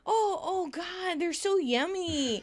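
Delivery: high, hammed-up delight over food; gushing. Rachel tasting Monica's cookies, mock gushing. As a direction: gush in a high, stretched voice.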